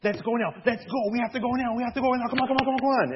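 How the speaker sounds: urgent rallying. Retold or imitated. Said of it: "all like".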